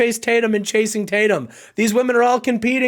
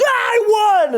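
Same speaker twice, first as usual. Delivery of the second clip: much higher, louder cry of triumph; hammed-up gloating win cheer; gleeful victory cry; 13 semitones higher, 3 dB louder than usual. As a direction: squeal it like you just won the prize. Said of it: "He goes".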